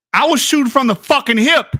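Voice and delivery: triumphant repeat. Real, not acted. Repeats the boast louder.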